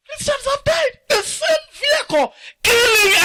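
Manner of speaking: high, crying wail over the dead; crying shout. Real, not acted. Preacher mourning slain Christians to the congregation, crying shout. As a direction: cry it out, grief breaking through the shout.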